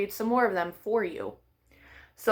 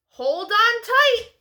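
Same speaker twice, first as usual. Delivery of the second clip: calling out; 10 semitones higher, slower than usual. Acted. Dad calling after Froggy.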